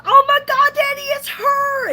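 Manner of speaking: high, loud mock squeal; hammed-up fangirl shriek; imitated excited fan. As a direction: squeal it like a starstruck fan, drawing out the end. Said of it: "And she was so excited".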